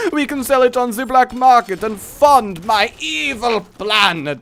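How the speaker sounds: high, loud hammed-up villain; mock evil villain. Villain plotting to fund an evil plan, gloating. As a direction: gloat over your scheme like a cartoon mastermind.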